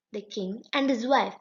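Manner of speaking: plain reading voice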